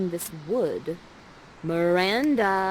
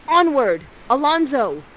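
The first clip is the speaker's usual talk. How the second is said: louder and higher, a hammed-up captain's cry; rallying call; 5 semitones higher, 8 dB louder than usual. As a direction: rouse the lords to march. Leading the group onward.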